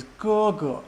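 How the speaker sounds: "ge, ge" as high level syllable, then short neutral one; high steady "ge", brief unstressed "ge"; first then fifth tone. Shown as a demonstration. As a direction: hold the first "ge" high, drop off on the second. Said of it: "that's first tone".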